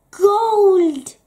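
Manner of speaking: loud, high, long-held cry; robber's shout. Acted. Robbers spotting the golden carriage, shouting loudly. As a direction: bellow it out on sighting treasure.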